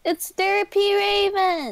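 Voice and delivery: high excited announcement; gasp, then cry out the arrival; delighted high call; excited greeting. Real, not acted. Spots a friend joining the chat.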